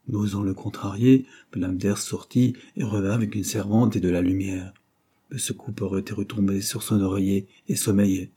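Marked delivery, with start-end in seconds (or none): none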